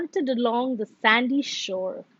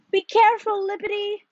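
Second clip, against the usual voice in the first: high, raised call; 9 semitones higher, faster than usual. A narrator's reading. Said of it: "called Little Brown Bear".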